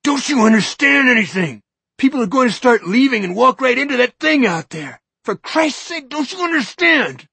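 a shrill desperate plea; desperate shout. Acted. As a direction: shout him into understanding.

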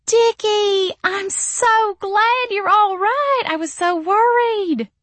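high, slow, sugary gushing; Missy's syrupy voice. A narrator's reading. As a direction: fake relief poured on thick. Missy gushing falsely at Dick.

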